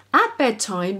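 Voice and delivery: ordinary delivery, nothing marked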